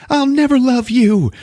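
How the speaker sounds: high, loud victim's cry; mock anguished cry. Victim rejecting killer, retold.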